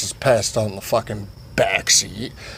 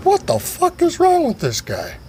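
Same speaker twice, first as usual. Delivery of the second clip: very high-pitched mock disbelief; baffled outrage. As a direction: squeak it out in exasperation. Acted disbelief at the friend.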